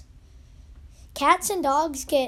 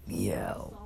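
low flat meow; cat meow; 6 dB quieter than usual. Retold or imitated. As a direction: mimic the cat lazily. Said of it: "the cat is like".

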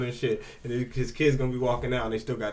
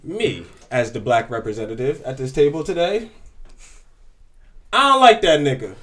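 mock formal declaration; 2 semitones higher, 9 dB louder than usual. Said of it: "You now have the floor".

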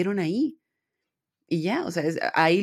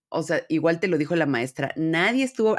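normal talking voice

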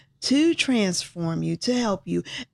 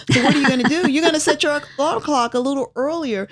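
rising excitement; 8 semitones higher, 4 dB louder, much faster than usual. Real, not acted. Rousing listeners with question.

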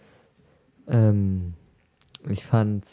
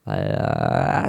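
deep voice; 14 semitones lower than usual. Acted. Sudden deep put-on voice.

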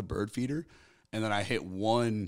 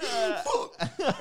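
very high startled mock curse; panicked gasp; 5 dB louder than usual. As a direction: blurt it as the cop appears. Retold panic seeing cop.

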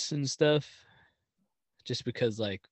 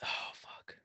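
whisper; 14 dB quieter than usual. Real, not acted. Muttered aside.

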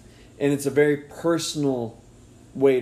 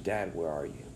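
much softer and lower, a broken whisper-like ask; hushed pained plea; 8 semitones lower, 9 dB quieter than usual. Retold or imitated. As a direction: barely voice it, like a hurting child. People asking their absent dad.

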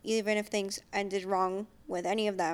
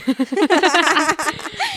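laughing; 6 semitones higher, 10 dB louder than usual. Real, not acted. Shared laugh after gratitude.

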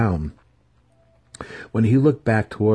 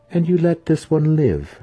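knowing matron; 9 semitones higher, 5 dB louder than usual. Acted. Matron reasons, smiling.

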